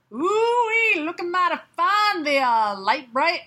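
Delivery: high, whooping Southern cowboy come-on; Southern cowboy accent. Acted. Cowboy admires Light Bright, hammed-up Southern accent. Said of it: "say it in an accent".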